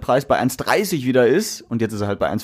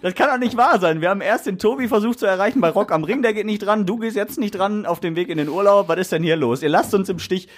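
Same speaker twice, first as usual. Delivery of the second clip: answering machine message; 7 semitones higher than usual. Pretend. Leaving mock-angry voicemail for boss.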